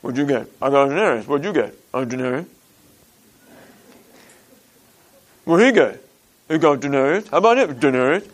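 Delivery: low, quiet muttering between labourers; grumbling workers. Retold or imitated. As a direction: mutter like sulky workers comparing their pay. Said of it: "That's exactly how they talk".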